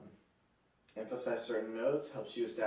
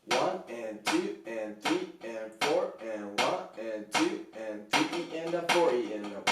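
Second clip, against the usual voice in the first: counting slowly and evenly, one syllable per beat. Shown as a demonstration. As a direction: lay out "one and two and" slowly like a metronome. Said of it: "we're just gonna go".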